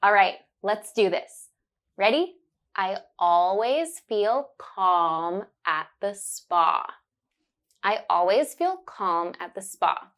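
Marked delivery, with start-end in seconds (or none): 2.8-6.9 slow, deliberate, every ah sound clear; slow and careful. Shown as a demonstration. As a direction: spell it out slowly for the learner. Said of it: "say it slowly and focus on the sound".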